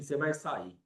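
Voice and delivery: soft, low, calm order; Jesus speaking softly to death, calm authority; quiet command. Acted. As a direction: tell death quietly it must leave. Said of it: "Fala assim".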